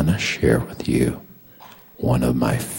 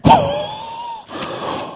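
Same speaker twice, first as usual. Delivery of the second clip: long, very high gasp; astonished gasp. Acted. Preacher acts out discovering a verse, astonished gasp. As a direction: gasp as if struck by a revelation.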